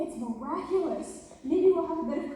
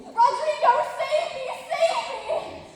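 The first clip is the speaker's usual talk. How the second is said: much higher, loud frantic melodrama cry; frantic damsel cry; 14 semitones higher, 9 dB louder, slower than usual. Shown as a demonstration. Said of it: "stagger across the room crying frantically".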